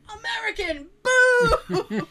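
high, loud booing of a hostile audience; mock heckling crowd. Friend imagines audience hearing an American, mock high booing. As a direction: jeer like a heckler, hammed-up. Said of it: "people are gonna be like".

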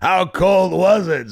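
booming announcer setup line; Ed McMahon voice. Retold or imitated. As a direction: stretch it out like a late-night show cue. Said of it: "And he goes".

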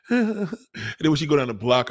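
ordinary delivery

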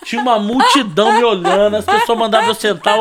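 normal talking voice

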